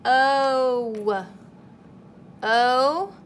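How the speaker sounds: O said loud and held alone; teacher sounding out O; isolated vowel. Shown as a demonstration. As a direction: call out the O sound clearly. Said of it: "keep saying it slowly".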